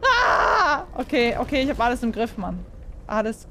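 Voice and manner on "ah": high loud scream. Real, not acted. Gamer under attack, high loud scream. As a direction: scream in fright. Scare in game.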